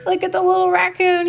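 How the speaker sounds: high, fast and bright pointing it out; excited. Real, not acted. Mother shows child the raccoon, high and excited. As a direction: point at the picture with delight.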